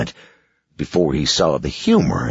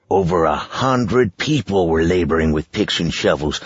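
plain reading voice

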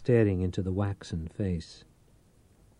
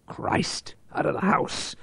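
breathy whispered curse; whispered dismay; 7 semitones higher than usual. Acted. Reich learns the girl fled, whispering his dismay. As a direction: whisper it in disbelief.

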